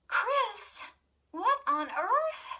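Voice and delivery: high, startled call from upstairs. A narrator's reading. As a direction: call down in alarm. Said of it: "Lindy's startled voice".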